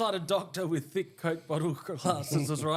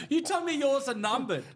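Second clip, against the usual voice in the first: laughing; 8 semitones higher than usual. Real, not acted. Teasing while laughing.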